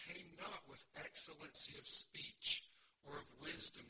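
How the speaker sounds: loud, declarative Bible reading from the pulpit; scripture reading. Real, not acted. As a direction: proclaim the verse with weighted key words. Reads scripture aloud to congregation.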